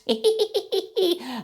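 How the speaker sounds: high-pitched, rapid mock cackle; very high tittering "hee-hee-hee"; Salacious Crumb cackle. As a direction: badly imitate a little creature's hysterical laugh. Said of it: "That's a really bad impression".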